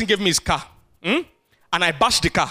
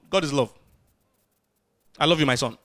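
tender voice of God; 6 semitones lower, faster than usual. Retold or imitated. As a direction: soothe the sinner like a loving father. God voiced answering sinner.

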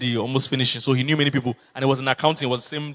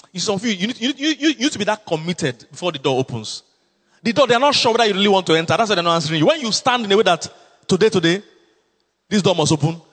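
rising, louder preaching push; 4 semitones higher, 3 dB louder than usual. Acted. Pastor urging the congregation to stand firm, preaching louder. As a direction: drive the point home to the church.

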